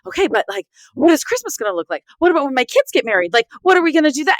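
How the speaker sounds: spiraling panic building to a high fast pitch; panicked questions. Retold or imitated. Divorced mom retelling early-days worries, rising panic. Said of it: "I was like".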